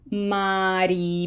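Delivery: syllables held long and even; slow, long vowels. Shown as a demonstration. Teacher building a habit in students, slow long vowels. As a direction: draw out every vowel for learners to copy. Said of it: "Say it slowly like I'm saying it".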